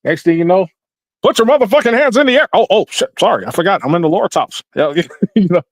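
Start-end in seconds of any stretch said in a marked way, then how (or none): 1.2-4.6 higher, hyped call then sheepish backpedal; Obama in church. Retold or imitated. Comedian voicing Obama getting excited during church service, then sorry. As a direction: get carried away, then apologize. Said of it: "get a little excited in church".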